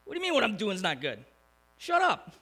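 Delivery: mock outrage ending in a high, sharp "Shut up"; mock losing it. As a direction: play Moses losing his temper, half in jest. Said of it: "Moses probably could have lost it".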